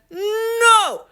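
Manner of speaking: high, drawn-out yell; stubborn refusal. A narrator's reading. Father arguing with the cashier, stubborn shout. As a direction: hold it high, refusing to give in.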